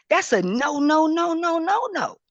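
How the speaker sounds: rising, insistent string of no; firm repeated no; emphatic no. Real, not acted. Emphatic refusal.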